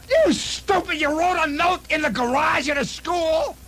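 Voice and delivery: hammed-up angry old man, pitch way up; furious father. Father scolding the son.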